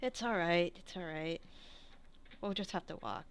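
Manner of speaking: very soft, low-key comfort; soothing reassurance. Acted. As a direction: murmur comfort to a friend. Consoling after gentle pats.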